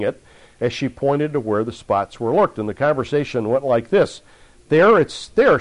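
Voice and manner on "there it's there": woman pointing. Retold or imitated. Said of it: "she told him".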